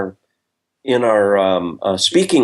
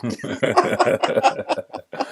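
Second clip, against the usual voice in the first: a sudden high, breathless laugh at his own joke; high breathy laugh; 14 semitones higher, 5 dB quieter than usual. Real, not acted. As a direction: laugh out at the punchline, airy and high.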